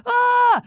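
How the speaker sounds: high, loud startled "ah"; mock scared scream. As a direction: cry out as if jump-scared, playacting. Imitates viewer scared by the face.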